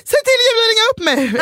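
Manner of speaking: high, loud, fast wailing demand; hammed-up frantic wail; frantic plea. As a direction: beg desperately, near tears. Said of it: "Alltså jag bara".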